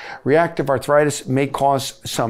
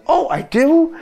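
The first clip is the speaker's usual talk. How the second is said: mock patient reply; 13 semitones higher than usual.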